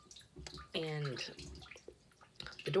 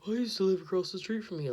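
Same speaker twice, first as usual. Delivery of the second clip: imitating the stranger; 4 semitones higher, 8 dB louder, faster than usual. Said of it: "the dude was like".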